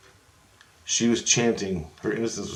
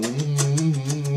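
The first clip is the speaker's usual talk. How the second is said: higher, drawn-out "mm-hmm" hum; affectionate kissing hum; 5 semitones higher than usual. Retold or imitated. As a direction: hum it as if smothering someone in kisses. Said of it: "started hugging her and kissing her, going".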